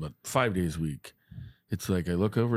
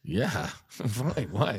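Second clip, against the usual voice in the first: laughing; 3 semitones higher, slower than usual. Retold or imitated. Retelling chat with Jake, laughing.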